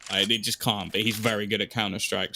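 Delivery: ordinary delivery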